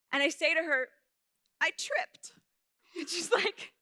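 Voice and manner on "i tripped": put-on small, high child's voice; innocent fib. Child hiding the truth from mom, high innocent voice. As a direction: pitch it up like a kid fibbing.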